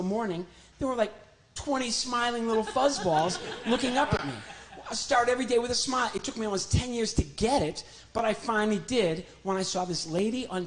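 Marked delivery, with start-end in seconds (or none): 4.9-6.1 cheerful quote. Retold or imitated. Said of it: "her favorite was".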